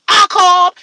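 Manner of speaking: hammed-up angry husband; husband slamming it down. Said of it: "slammed that shit on the table and said".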